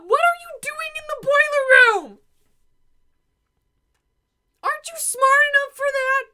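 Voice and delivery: high, loud crying protest; wailing grief. Acted. Student mourns the dead lawyer, wailing grief. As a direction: howl in denial, pitch way up. Grieving over the body.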